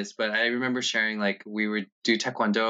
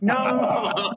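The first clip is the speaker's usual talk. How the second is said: laughing no; 14 semitones higher than usual. Retold or imitated. Refusing dad, laughing.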